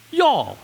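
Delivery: "y'all" said as one syllable, yawl, high; Southern y'all. Shown as a demonstration. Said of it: "and then there's a plural".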